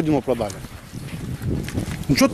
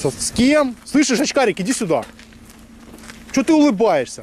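real heated, raised-pitch confrontation; angry challenge; 5 semitones higher, slower than usual. Real, not acted. Angry man summoning the bespectacled opponent, aggressive. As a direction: call him out, spoiling for a fight.

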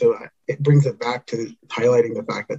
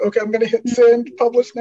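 high, put-on nervous self-talk, a little louder; bracing yourself before clicking send, much higher pitched; nervous inner voice; 11 semitones higher, 4 dB louder than usual. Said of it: "I was like".

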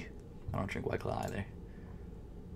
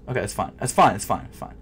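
ordinary talk, nothing marked

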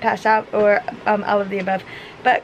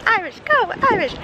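cheering; 9 semitones higher than usual. Real, not acted. Cheering at the game.

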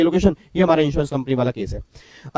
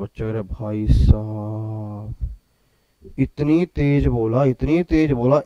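mock student complaint; 7 semitones lower, 5 dB quieter, much slower than usual. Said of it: "sir ने क्या बोला".

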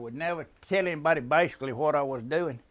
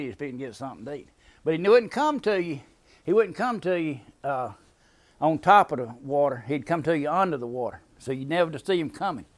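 usual speaking voice

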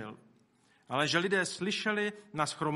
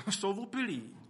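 mock scoffer's jeer, pitched up; 4 semitones higher than usual. Preacher imitating the scoffers at Pentecost, mocking. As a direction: lightly sneer it with a chuckle before it. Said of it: "druhý s posměškem říkali".

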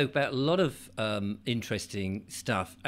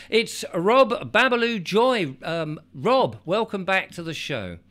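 showman intro; 8 semitones higher, 6 dB louder, slower than usual. Acted.

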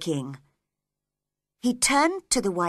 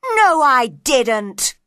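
slow, loud, raised protest; loud denial; 4 semitones higher, 10 dB louder, much slower than usual. A narrator's reading. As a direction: deny it loudly, stretching the words. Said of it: "said the knave loudly".

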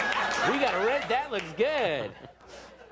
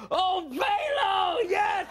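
very high, slow, shouted delight; hammed-up shout of relief; overjoyed shout; much slower than usual. Answering the long-awaited caller, hammed-up joyful shout. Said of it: "Chinese zoom on me".

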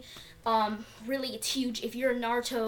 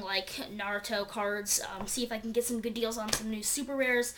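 ordinary talk

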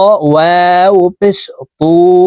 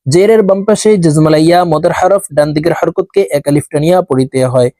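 ordinary delivery

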